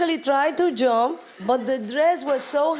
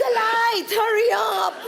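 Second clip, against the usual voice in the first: very high, urgent imitation of a director; mock scream; 10 semitones higher than usual. Actress imitates Mateo screaming for the light, mock scream. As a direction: yell it like a frantic director, played for laughs. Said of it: "Mateo is screaming".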